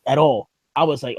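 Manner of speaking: usual speaking voice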